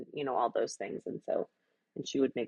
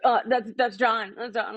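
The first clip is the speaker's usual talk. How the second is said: imitating husband; 6 semitones higher, 3 dB louder than usual. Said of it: "He'll be like".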